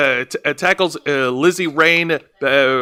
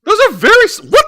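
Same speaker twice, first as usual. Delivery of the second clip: excited; 5 dB louder, faster than usual. Real, not acted.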